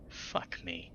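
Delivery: exasperated whispered groan. A narrator's reading. Unknown voice fed up with friends, whispered groan. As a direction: groan it under your breath. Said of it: "The voice groans".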